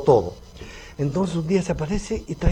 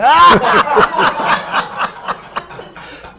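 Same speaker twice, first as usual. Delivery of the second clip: loud laughter; 7 dB louder than usual. Real, not acted. Friends cracking up at the Argentine joke, loud laughter. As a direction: burst of high, loud laughter.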